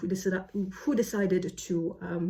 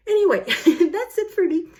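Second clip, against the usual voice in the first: laughing; 8 semitones higher, 8 dB louder than usual. Real, not acted. Laughing while wrapping up.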